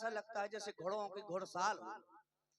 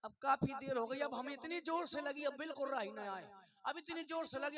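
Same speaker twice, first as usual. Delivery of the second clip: usual speaking voice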